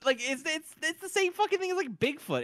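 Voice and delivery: high, squeaky exasperation; exasperated. Real, not acted. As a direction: rant in disbelief, pitch soaring. Exasperated rant about ban.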